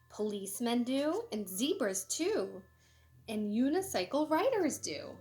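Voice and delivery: lively, sing-song storybook reading; animated picture-book read-aloud; reading to children. Acted. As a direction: read it bright and bouncy for kids.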